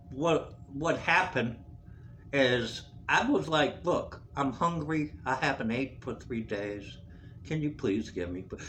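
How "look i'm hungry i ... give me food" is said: subdued plea. Retold or imitated. Pleading for food, retold.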